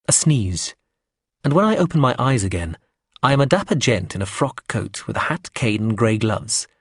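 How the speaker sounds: usual speaking voice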